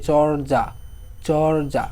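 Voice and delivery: চর্যা slowly as "chor-ja", no vowel after r; vowelless r. Shown as a demonstration. Teacher showing students the r has no vowel, drawn out. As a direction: draw out চর্যা, holding the r before ja. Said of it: "র ধরে উচ্চারণ করতে".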